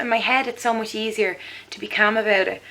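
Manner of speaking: usual speaking voice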